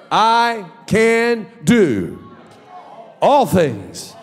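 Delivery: slow, shouted proclamation; full-force sermon shout; preaching declaration. Acted. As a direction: preach it like a battle cry.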